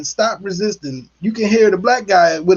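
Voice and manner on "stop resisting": normal talking voice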